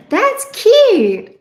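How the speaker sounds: high, loud burst of delight; delighted squeal. Real, not acted. Reader delights in a viewer's cute name, squealing.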